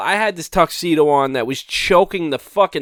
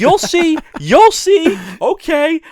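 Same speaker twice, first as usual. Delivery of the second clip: high, louder mock-defensive voice; mocking cousin imitation; 11 semitones higher, 5 dB louder, slower than usual. Teasing retelling of the crying cousin's comeback, high mocking imitation. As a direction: imitate a weepy groom defending himself, pitched way up. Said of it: "I've been making fun of him".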